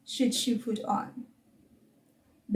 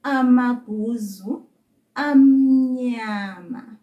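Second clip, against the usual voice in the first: drawn-out syllables, louder on amnyama; slow teaching model; 6 dB louder, slower than usual. Shown as a demonstration. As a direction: stretch amnyama out long and clear. Teacher models the Zulu phrase.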